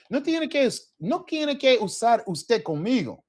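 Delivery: high, eager friendly voice; a native speaker waving off the formality; friendly local. Retold or imitated. Telling the learner to drop usted, high and friendly. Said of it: "the person will then say".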